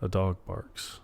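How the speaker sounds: soft, slow deadpan; low-energy, matter-of-fact murmur; bored prediction. Retold or imitated. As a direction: imitate calling events quietly before they happen. Imitates Phil predicting events, bored.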